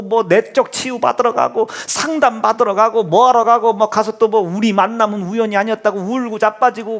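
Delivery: normal talking voice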